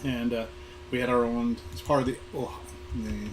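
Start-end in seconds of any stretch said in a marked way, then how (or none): none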